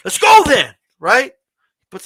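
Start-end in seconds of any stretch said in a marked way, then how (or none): 0.1-0.7 higher and louder, daring him to fight; a wrestler raising his fists and yelling "come on"; fired-up challenge. Retold or imitated. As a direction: call him out to fight, pumped up. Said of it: "gets his hands up and says".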